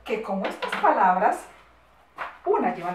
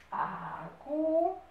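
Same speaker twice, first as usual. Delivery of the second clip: very slow, higher, "Agudas" in stretched syllables; syllable-by-syllable dictation; 5 semitones higher, 4 dB quieter, much slower than usual. Shown as a demonstration. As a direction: stretch "Agudas" out for pupils writing.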